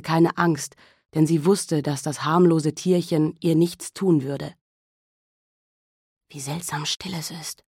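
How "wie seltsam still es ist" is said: hushed. Acted. Mary alone in silent house.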